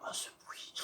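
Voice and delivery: breathy, hushed whisper of awe; soft, voiceless whisper of wonder; awed whisper. Real, not acted. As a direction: whisper as if the engine sound is sacred. Awed by engine sound.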